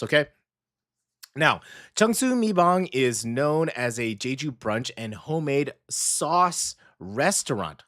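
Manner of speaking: ordinary talk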